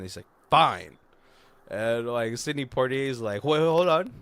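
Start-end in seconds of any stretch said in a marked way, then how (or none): 0.5-1.0 loud, grudging mock-angry concession; exasperated giving in. Mad agent conceding the Winnebago, exasperated. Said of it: "he's so mad". 3.4-4.1 loud, higher acted interruption; urgent interjection. Poitier interrupting the negotiation, urgently.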